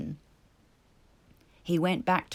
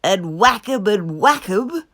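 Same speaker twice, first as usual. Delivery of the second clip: louder gleeful cry; ecstatic Toad; 3 semitones higher, 10 dB louder than usual. A narrator's reading. Toad imagining beating the stoats, ecstatic. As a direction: relish every whack. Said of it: "cried Toad in ecstasy".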